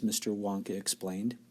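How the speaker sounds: ordinary delivery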